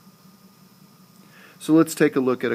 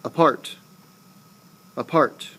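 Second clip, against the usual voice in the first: Apart with a barely uttered A; stress on second syllable. Shown as a demonstration. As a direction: skim the A, land on part in Apart. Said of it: "you barely utter the syllable".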